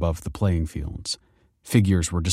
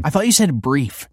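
higher, pointed cut-in; impatient interruption; 6 semitones higher than usual. A narrator's reading. Pupil breaking into principal's lecture, interrupting impatiently. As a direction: cut across them impatiently. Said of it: "interrupted Kelsey".

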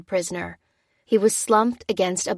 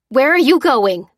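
loud, higher shout after them; alarmed call; 6 semitones higher, 9 dB louder than usual. Acted. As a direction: call out as they leave. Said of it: "cried the sergeant".

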